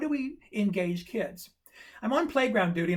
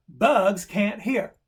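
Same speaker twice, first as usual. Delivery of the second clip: slow, weighty "Bugs can't hear", louder on "Bugs"; emphatic announcement; 5 dB louder, much slower than usual. Retold or imitated. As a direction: land each word of "Bugs can't hear" slowly. Acted boy announcing his big discovery.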